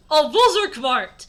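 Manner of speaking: loud, high, drawn-out cry; mock exclamation. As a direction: wail the name with hammed-up drama. Acted mock lament in the story.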